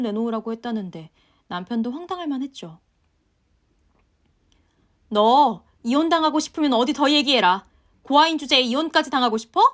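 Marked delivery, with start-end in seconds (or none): 5.1-9.6 loud, sneering threats from the mother-in-law; yelling mother-in-law. Acted. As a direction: shout her down and threaten divorce. (고래고래 소리 지르는 시어머니)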